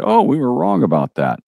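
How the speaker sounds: mock sheepish admission. Experts admitting years later they were wrong, mock realization.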